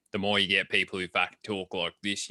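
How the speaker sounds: a light put-on thick Australian bogan accent. Shown as a demonstration. Describing inland Aussies to a podcast guest, mild bogan accent. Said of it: "real thick fucking Australian bogan accents".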